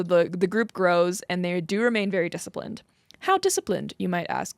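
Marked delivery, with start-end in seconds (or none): 3.3-3.9 curious question. Retold or imitated. Imitates a listener asking.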